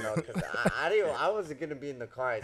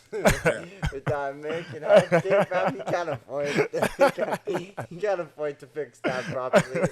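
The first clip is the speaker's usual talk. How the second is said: laughing; 4 semitones higher, 7 dB louder than usual. Real, not acted. Joking about jaw healing.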